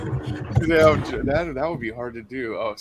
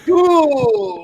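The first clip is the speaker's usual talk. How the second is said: loud, high counting Count; mock Count counting; 14 semitones higher, 8 dB louder than usual. Player doing the Count for the host, loud and high. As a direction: belt it like a cartoon Count. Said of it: "Can she get a Count Dracula?".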